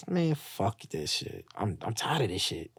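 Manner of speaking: soft, sinking, worn-out murmur; retold weariness. Man telling how tired of life he felt, weary and quiet. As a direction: say it drained, fading low and quiet. Retelling his despair before overdose.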